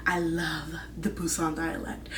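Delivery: whispered confession of love; whispering her love for the dialect; dreamy whisper. Real, not acted. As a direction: breathe it like a secret. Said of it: "whispered confession".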